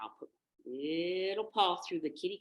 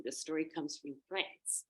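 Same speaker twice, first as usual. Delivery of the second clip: plain reading voice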